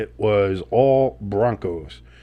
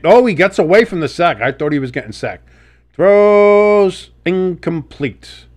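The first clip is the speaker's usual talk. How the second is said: play-by-play call; 2 semitones higher, 4 dB louder than usual. Acted. Streamer calling Goff's scramble for viewers, excited play-by-play. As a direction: call the scramble and the miss like a broadcaster.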